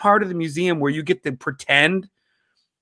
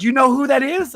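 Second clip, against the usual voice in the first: high-pitched, excited retold question; eager question; 10 semitones higher than usual. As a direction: ask it like an eager kid. Kid quizzes him about the photo.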